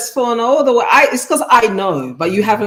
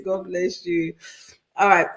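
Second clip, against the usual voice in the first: ordinary talk, nothing marked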